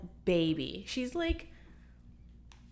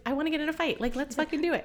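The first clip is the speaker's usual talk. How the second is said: mock fight eager; 7 semitones higher, much faster than usual. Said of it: "I was like".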